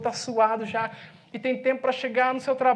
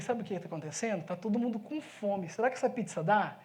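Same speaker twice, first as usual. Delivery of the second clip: lower, much softer aside; quiet confiding; 4 semitones lower, 7 dB quieter than usual. Retold or imitated. Pastor confiding in the pizza man, hushed. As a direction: lean in and murmur just to him.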